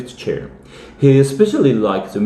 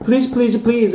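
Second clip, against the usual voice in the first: pleading; 12 semitones higher than usual. Acted. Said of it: "cried Toby".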